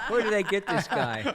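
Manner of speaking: loud, high laugh through the words; laughing out loud while talking. Real, not acted. Laughing at own joke.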